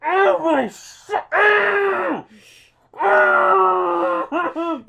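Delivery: a dragon stabbed in the mouth, wailing and cursing; howling wounded dragon. Acted. Stabbed dragon howls in pain.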